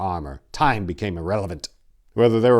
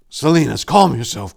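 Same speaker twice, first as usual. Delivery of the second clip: ordinary delivery, nothing marked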